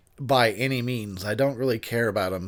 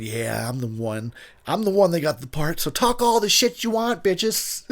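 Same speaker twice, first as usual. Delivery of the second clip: cocky boast climbing higher and higher; gloating star; 6 semitones higher than usual. Acted. Lead actor answering jealous extras, gloating. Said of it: "you're just there going".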